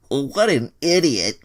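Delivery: high, loud, slow sneer; scornful thought. Acted. Troy mocks the werewolf, high drawn sneer. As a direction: sneer at him, stretched out. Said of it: "Troy thought to himself".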